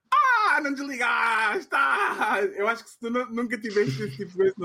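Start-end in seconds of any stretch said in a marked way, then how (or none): 0.1-2.4 high, shouted playful outrage; mock outrage. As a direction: cry out in fake betrayal. Mock outrage partner didn't hang up.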